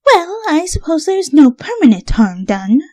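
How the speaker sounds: high, airy forgiveness; gracious Rarity. A narrator's reading. Rarity forgives Rainbow for eating her. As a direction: forgive with elegance.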